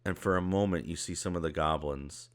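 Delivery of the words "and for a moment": normal talking voice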